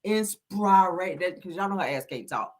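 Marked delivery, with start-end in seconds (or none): 0.0-1.2 "Inspiration" drawn out slowly, sounded out; mock thick tongue. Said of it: "sounding it out in thick tongue".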